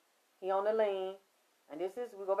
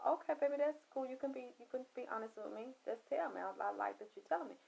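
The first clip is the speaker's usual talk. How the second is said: softer, fast, fake-sweet reassurance; mock soothing coaxing; 10 dB quieter, faster than usual. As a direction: coax the truth out of him gently. Coaxing him to confess more.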